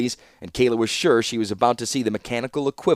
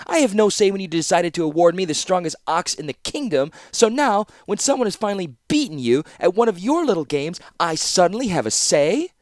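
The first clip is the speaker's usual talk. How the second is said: higher, heated rising tirade; indignant outburst; 8 semitones higher than usual. A narrator's reading. Kayla confronting her father, angry outburst. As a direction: let the anger build.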